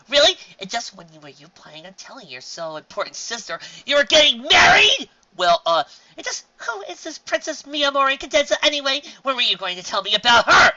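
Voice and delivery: high, loud indignant yelling; indignant shouting. Acted. Sister shouting at her brother about his fiancée, indignant. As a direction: demand answers at full volume.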